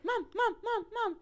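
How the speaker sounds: put-on high, repeated child's call for Mom; imitated pestering son. Son calling his busy mom, imitated high-pitched nagging. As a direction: nag like a small boy wanting attention. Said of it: "And he's like".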